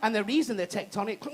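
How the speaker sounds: usual speaking voice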